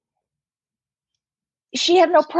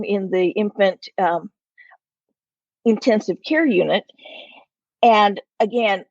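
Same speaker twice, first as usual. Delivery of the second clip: ordinary talk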